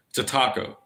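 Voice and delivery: "taco" with an open AH, TAH-co; Spanish-style "ah" in "taco"; correct taco vowel. Shown as a demonstration. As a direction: insist on TAH-koh for "taco". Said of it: "how do you not know that".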